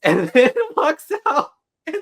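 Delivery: laughing through the words; giggling as she talks. Real, not acted. Laughing while retelling.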